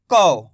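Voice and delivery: कौ said low and loud with au; model syllable kau. Shown as a demonstration. Teacher models कौ for students. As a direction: drop low and say कौ with an open au. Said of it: "औ की मात्रा है इसके अंदर".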